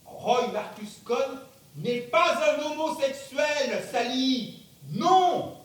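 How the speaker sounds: slow and loud, ending in a barked no; loud insistence. Acted. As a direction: declare it, then shout no. Roy insists on his identity.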